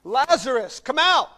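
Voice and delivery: high, booming shout; loud commanding call. Acted. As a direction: call out with full authority to raise the dead. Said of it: "Jesus called out in a loud voice".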